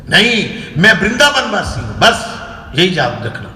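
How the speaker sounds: imitated stern correction, starting high and loud; retold rebuke, dropping to a firm finish; angry guru. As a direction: mimic an elder laying down the law. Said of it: "महाराज इतना गुस्सा हो गए".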